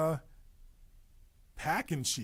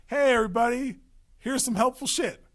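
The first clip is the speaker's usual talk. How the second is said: high, loud hail to a crowd; calling out; 11 semitones higher, 9 dB louder than usual. Acted. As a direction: announce it loudly to everyone. Calls out to everybody.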